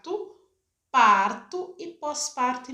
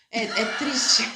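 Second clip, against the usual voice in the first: rueful laugh breaking into the words; louder, laughing as the words come out; laughing admission; 4 dB louder, slower than usual. Real, not acted. Doula admits the local scenario to host, laughing.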